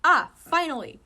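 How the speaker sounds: loud, high relieved cry; relieved triumph. Acted. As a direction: cheer on finding them. Finding the bowls.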